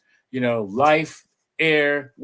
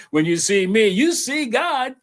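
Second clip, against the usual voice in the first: rising high and slowing on you see God; solemn proclamation as Jesus; 9 semitones higher, 4 dB louder than usual. Retold or imitated. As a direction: proclaim it like a revelation. Voicing Jesus' words.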